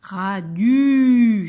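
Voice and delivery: "Radu" with a loud, long u; vowel sounding-out. Shown as a demonstration. Teacher making children hear the U, "Radu" ending loud and long. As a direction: stretch the last vowel of "Radu" loudly. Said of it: "j'entends U".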